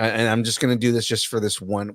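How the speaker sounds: usual speaking voice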